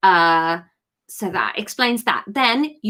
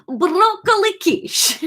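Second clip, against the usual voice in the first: slow, laughing Liverpudlian Greek "broccoli quiche"; Liverpudlian Greek accent; 10 semitones higher, slower than usual. Acted. [name] tells Kate his dinner in a Liverpudlian Greek accent. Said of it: "let's go Liverpudlian Greek".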